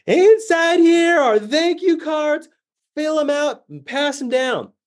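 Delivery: high, slow, loud instructions over engine noise; shouting over a plane engine to elderly women; mock yelling. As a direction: call it out slowly to hard-of-hearing ladies. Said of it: "yelling the instructions at them".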